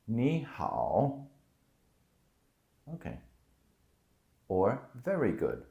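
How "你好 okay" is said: usual speaking voice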